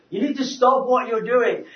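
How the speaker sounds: mock-earnest scolding; retold confrontation. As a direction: confront him, re-enacting it. Retold confrontation with store clerk.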